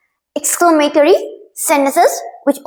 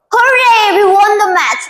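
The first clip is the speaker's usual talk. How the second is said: joyful shout of victory; excited cheer; 7 semitones higher than usual. Shown as a demonstration. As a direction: cheer it like your team just won. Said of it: "what do you say?".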